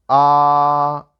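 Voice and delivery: "ah" held long on one level pitch, loud; first tone. Shown as a demonstration. Teacher shows students the single-rhythm tone, "ah" held level. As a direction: sustain "ah" on a steady, unchanging note. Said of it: "We pronounce this as a single vocal cord".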